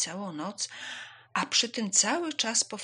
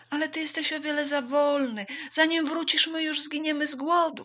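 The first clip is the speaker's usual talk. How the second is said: high, loud objection; raised, impatient, alarmed voices; protesting animals; 9 semitones higher, 7 dB louder than usual. Acted. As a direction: complain urgently that it is hopeless.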